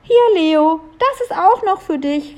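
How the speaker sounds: put-on high, spirited girl's shout; calling out. A narrator's reading. Julia pelts Leo with snowballs, calling out. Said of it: "rief Julia".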